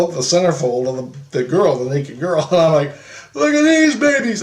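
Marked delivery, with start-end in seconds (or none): 3.3-4.4 very high, crowing show-off voice; high, taunting excitement; gleeful showing off. Retold or imitated. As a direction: crow it high to the whole class. Showing kids centerfold to spite Christ.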